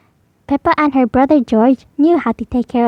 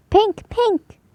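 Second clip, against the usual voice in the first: put-on high little-boy squeak; George shouting; 8 semitones higher than usual. Little brother George squeaking his request. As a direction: squeak it out like a toddler. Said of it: "shouted George".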